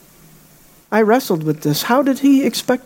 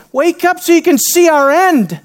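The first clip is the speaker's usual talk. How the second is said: urgent wake-up call; 7 semitones higher than usual. Retold or imitated. Frightened disciples rousing Jesus, alarmed. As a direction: wake him in a panic.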